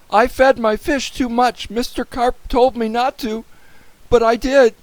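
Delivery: high, loud, urgent wail; panicked confession. A narrator's reading. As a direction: confess it desperately to the police. Boy confesses in panic by phone.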